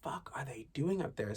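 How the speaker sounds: an unvoiced, disbelieving whisper; whispered what-are-they-doing-up-there. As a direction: hiss it under your breath like you can hear them through the ceiling. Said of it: "And it's just like".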